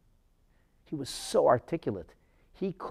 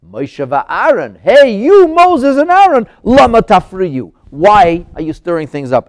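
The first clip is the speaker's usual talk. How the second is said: very loud, high calling out; direct challenge; 5 semitones higher, 16 dB louder, slower than usual. Acted. Pharaoh confronting Moses and Aaron directly, loud and challenging. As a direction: hail them sharply and demand an answer. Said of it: "He should have said".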